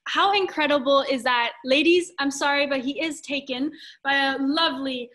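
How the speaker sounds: ordinary talk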